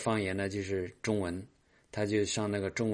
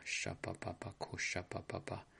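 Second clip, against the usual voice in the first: speaking in tongues; 11 dB quieter than usual. Acted.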